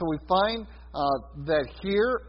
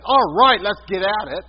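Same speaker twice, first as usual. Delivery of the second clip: hearty louder let's-go shout; eager rallying call; 2 semitones higher, 7 dB louder than usual. Retold or imitated. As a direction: fire up the crowd like a martyr ready to go. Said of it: "nothing from the martyrs saying".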